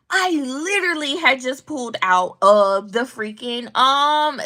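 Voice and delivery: ordinary delivery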